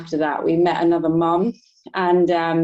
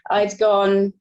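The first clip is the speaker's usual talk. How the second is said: ordinary delivery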